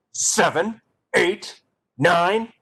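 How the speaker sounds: slow, rising angry count. Shown as a demonstration. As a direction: spit the numbers out in anger. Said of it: "I want you to be angry".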